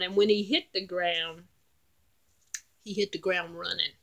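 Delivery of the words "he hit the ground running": ordinary delivery, nothing marked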